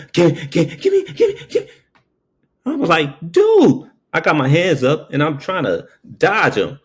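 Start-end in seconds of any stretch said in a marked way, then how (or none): 0.1-1.7 much higher, frantic stammer of a jittery gunman; panicked robber stammering. Retold or imitated. Robber waving gun, stammering. 3.3-3.9 much higher and louder startled "Dude"; startled yelp. Retold or imitated. Gun pointed at him.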